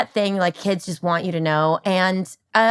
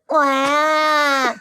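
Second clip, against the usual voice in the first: doll cry; 7 semitones higher than usual. Retold or imitated. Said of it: "she would be like".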